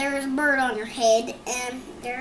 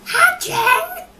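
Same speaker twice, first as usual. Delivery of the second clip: very high, loud cry of alarm; shocked shriek; 9 dB louder than usual. Acted. Elephant shrieking that the eggs on his head are hatching. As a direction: shriek it in disbelief.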